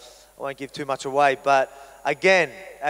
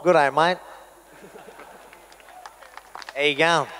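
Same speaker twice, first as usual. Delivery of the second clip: bright, friendly "G'day, mate" with a rise; cheery greeting. Shown as a demonstration. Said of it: "Yeah, why not?".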